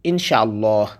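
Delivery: ordinary delivery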